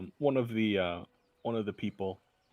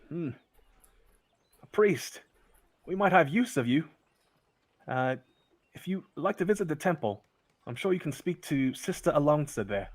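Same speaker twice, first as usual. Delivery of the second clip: higher, louder, businesslike; constable's voice; 5 semitones higher, 4 dB louder than usual. Acted. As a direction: recruit the priest politely. Constable addresses the priest.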